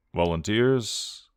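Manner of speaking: slow, wry question; a dark joke to break the tension; inopportune joke. Acted. Johnny asking the others for volunteers, wryly. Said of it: "Johnny's sense of humour".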